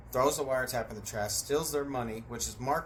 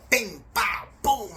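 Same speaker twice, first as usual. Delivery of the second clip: fight sound effects; 9 semitones higher, 7 dB louder, slower than usual. Retold or imitated. Imitating fight hits.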